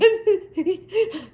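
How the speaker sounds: high, jerky mock sobs; hammed-up sobbing noise; imitated crying. Driver crying while phoning her husband, imitated sobs. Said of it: "you know, that crying".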